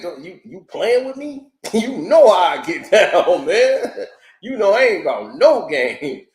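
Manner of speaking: mock angry Moneybags. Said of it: "He got a guy up there".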